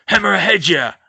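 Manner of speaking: loud spell cry; shout. Acted. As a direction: hurl the curse. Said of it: "with a shout of".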